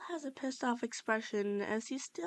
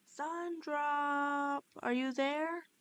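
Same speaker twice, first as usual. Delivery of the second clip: higher, calling out across an empty room; a searching call, pitched up; 5 semitones higher, much slower than usual. Acted. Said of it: "you yell out".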